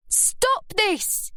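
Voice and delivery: high pleading cry. A narrator's reading. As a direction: call out to stop them, high. Scarlett breaking up fight.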